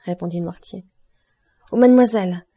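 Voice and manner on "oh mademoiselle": excited cry. A narrator's reading. Franz to Valentine, a loud hopeful cry. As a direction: call out in sudden hope. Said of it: "s'écria Franz".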